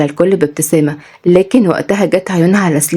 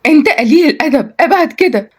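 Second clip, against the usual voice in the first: shocked scolding; 9 semitones higher than usual. A narrator's reading. Shocked girl pushes him away.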